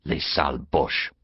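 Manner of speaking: low, quiet bitterness; bitter. A narrator's reading. Peasant woman cursing the Germans, bitter. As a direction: mutter the French insult bitterly. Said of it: "adding bitterly".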